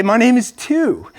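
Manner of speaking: put-on an eight-year-old gently insisting on his short name; boy introducing himself. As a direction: spell it out slowly for the class. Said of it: "So I say".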